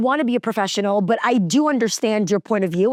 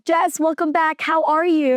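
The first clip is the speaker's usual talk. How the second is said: high-pitched imitation of a welcoming coworker; cheery greeting; 6 semitones higher than usual. Male coworker welcomes new mom back, imitated cheery greeting. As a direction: greet her brightly, pitched up.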